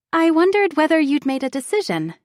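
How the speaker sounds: put-on high, sweet young woman; sugary and eager to please. A narrator's reading. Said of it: "she asks in her sweet voice".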